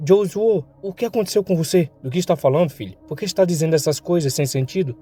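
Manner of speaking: a son swearing to protect them; emotional family talk. Acted. Hero then worried father. (diálogo emotivo, voz mais aguda)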